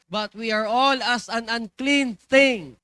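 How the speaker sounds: slow, loud scripture reading; proclaimed verse. Acted. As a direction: thunder the verse slowly. Verse read slowly, loudly.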